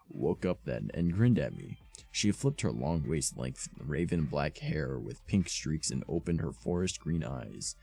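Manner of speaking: usual speaking voice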